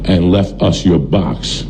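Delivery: slow, measured reading of a message; solemn message readout. Retold or imitated. Stranger tweeting that they have his box, slow and measured. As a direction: read it out slowly, letting each phrase land.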